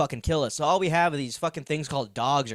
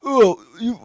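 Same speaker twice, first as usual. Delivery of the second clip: high mocking bro voice, louder; 7 semitones higher, 8 dB louder than usual. Mocking the guys who repost Patrick Bateman, bro voice. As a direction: sneer it like a gym bro.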